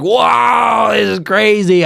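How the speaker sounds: loud, high, drawn-out wow; amazed. Retold or imitated. Tripping diner sees cavernous staircase, amazed. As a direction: marvel out loud, pitch soaring.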